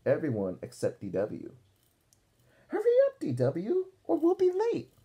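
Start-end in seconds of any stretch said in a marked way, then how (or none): 2.7-4.9 high, loud call; calling up the stairs. Acted. Mother calling D W downstairs, high and loud. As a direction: call out to hurry her. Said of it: "called Mother".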